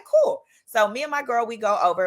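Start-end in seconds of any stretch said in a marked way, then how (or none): 0.0-0.4 eager. Retold or imitated. Eager agreement.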